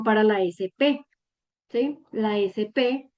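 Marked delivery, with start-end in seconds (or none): none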